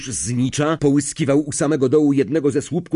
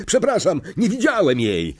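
higher mock-sorry call; called apology; 7 semitones higher than usual. Flint to Hooch, mock apology. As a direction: fake a careless apology. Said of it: "zawołał Flint".

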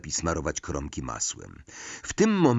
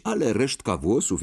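ordinary delivery

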